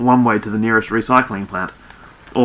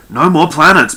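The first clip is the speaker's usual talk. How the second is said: loud, raised, insistent refusal; stubborn; 7 semitones higher, 9 dB louder than usual. A narrator's reading. Barducci repeating his ban on planet names, stubbornly. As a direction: dig in and refuse to budge. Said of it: "Barducci repeated stubbornly".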